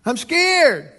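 put-on high child voice calling for help; little girl crying out. Scared little girl calls daddy from bed, mock frightened cry. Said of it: "She cries out".

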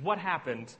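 ordinary delivery

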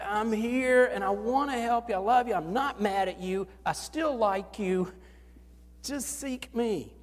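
voicing God, gentle and reassuring; tender voice of God. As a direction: speak as a loving God.

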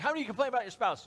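loud, high, quick call to the crowd; re-enacted question. Pastor asking last service about spouses, loud. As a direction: poll the room briskly and loudly.

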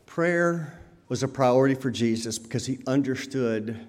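normal talking voice

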